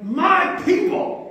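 high, drawn-out proclamation; emphatic, lingering divine declaration; God claiming his people. Acted. God's proud 'my people' moment.

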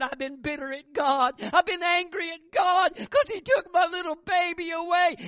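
high, loud confession of bitterness; anguished confession. Retold or imitated. Wife confesses to her husband that she was angry at God.

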